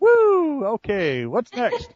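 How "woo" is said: whooping cheer. Real, not acted. Cheer after joke.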